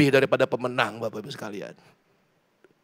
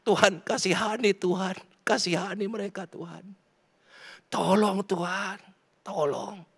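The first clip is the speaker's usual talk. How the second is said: retold crying prayer; 6 semitones higher, slower than usual. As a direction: beg for mercy as if weeping. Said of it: "Sambil nyetir saya cuma nangis". (meniru ratapan memohon kepada Tuhan)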